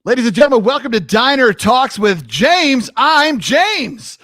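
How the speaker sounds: big, high-energy host intro; show announcer. Pretend.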